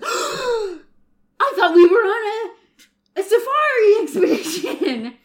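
high, slow shocked words; shocked gasp. Acted. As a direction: gasp it out in disbelief. Top Hat Rufus gasps.

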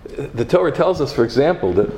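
loud, driving sermon cadence; preaching at full force. Acted. Rabbi preaching to his congregation, loud and driving.

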